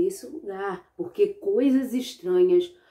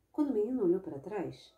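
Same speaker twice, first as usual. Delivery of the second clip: ordinary delivery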